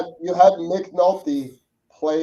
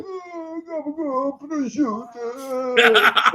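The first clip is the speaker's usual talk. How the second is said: higher, very slow hammed-up Italian American accent; mock Italian American accent; 8 semitones higher, much slower than usual. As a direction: stretch "prosciutto" like a cartoon Italian American. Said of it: "when you watch these guys be like".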